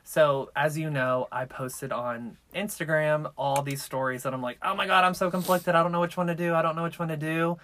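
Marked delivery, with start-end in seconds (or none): none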